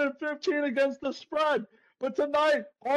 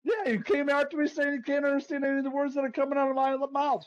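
ordinary talk

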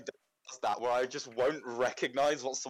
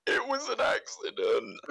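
squeaky high voice, drawn out and pleading; 10 semitones higher, slower than usual. Retold or imitated. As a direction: plead like a winded victim. Pleading after being hit.

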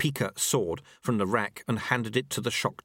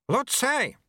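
higher, calling across the hall; urgent call; 3 dB louder than usual. A narrator's reading. Panting acolyte calls the old sweeper, urgent. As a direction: call him from the doorway.

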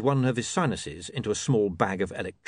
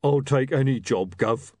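big slow-witted bruiser, a bit louder; rough job-seeker; 5 semitones higher, 4 dB louder than usual. Acted. Bruiser begging William for any job, role voice. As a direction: answer like a humble ex-boxer.

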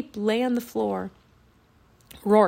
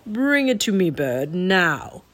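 commanding villain king; 2 semitones lower, 4 dB louder, slower than usual. A narrator's reading. Raven King ordering the bird to bring the wand, menacing command.

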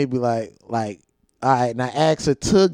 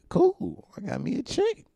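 put-on much higher, soft squeaky kid boast; mock proud kid; 11 semitones higher, 6 dB quieter than usual. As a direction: play your clueless fifth-grade self. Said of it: "I'm like".

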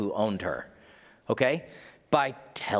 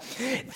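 1 laugh; 12 semitones higher, 5 dB quieter than usual. Acted. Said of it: "Couldn't help it".

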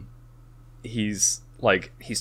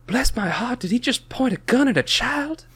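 put-on much higher, scandalised Southern lady accent. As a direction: clutch your pearls in a Southern accent. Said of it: "I went with a southern accent there".

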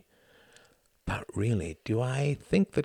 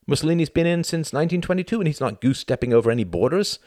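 agitated rant building higher and louder; mounting agitation; 11 semitones higher, 5 dB louder than usual. A narrator's reading. Tom argues with himself late at night, mounting agitation. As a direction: work yourself up into an exasperated tirade. Tom's mounting inner argument.

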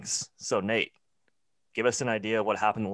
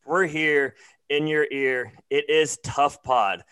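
announcer voice; 3 semitones higher, 4 dB louder, slower than usual. Acted. Hosted Christmas show intro.

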